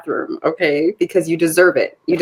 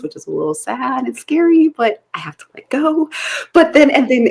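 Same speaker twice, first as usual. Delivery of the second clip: ordinary delivery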